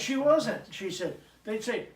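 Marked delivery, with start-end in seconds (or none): 0.0-0.7 higher, louder retold retort; insistent retort. Husband defending his wife to complainers, insistent retort. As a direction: push back, voice raised in pitch and volume.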